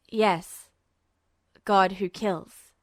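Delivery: low, slow and grim; Duo's voice. A narrator's reading.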